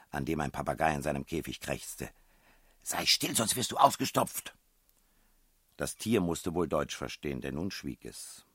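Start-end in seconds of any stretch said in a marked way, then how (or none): 2.8-4.6 raised, higher threatening call; scolding call. A narrator's reading. Princess threatening a screeching parrot, calling out. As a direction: call out a sharp warning. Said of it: "rief die Prinzessin".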